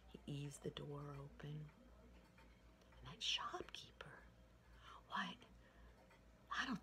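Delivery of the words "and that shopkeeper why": ordinary delivery, nothing marked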